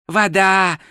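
usual speaking voice

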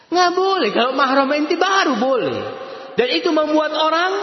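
high, forceful preaching warning; emphatic preaching. Real, not acted. Preacher warning the congregation, high and forceful. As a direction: press the prohibition hard. Preacher forbids touching, rising fervor.

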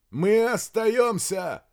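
high, loud, drawn-out shout; shouted refusal. A narrator's reading. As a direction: declare it across the distance. Shouting back to colonel. (громко прокричать отказ)

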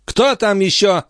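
high-pitched, annoyed call; irritated cry. A narrator's reading. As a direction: cry out testily at the door. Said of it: "раздражённо вскричала графиня".

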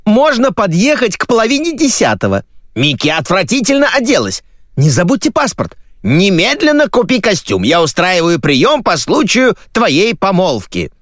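two high, overlapping-minded women's voices; barrage of shouting. Acted. Two women bombarding him on two phones, shouting. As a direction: fire off orders in high, insistent cries. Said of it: "слились в одну канонаду".

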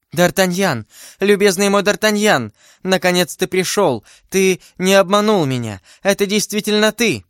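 high, elated heroine's voice; overjoyed that he has really come; joyful greeting. Acted. Heroine to her beloved at last arrived, overjoyed.

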